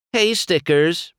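bright teasing shout-over; calling out. Acted. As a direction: call across to a friend. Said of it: "she called".

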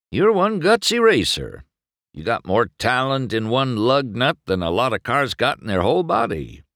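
put-on high, praising old racer; hearty pat on the back; proud veteran compliment; the king.

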